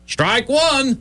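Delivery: high, sing-song umpire call of a strike. Acted. Barkeep calls a strike on Casey, mock umpire voice. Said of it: "the barkeep said".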